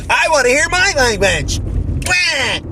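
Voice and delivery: high-pitched whiny mock of a commentator; mock crying complaint. Podcaster mimics commentator upset over Spanish songs, high mock whine. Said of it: "she goes on to cry about".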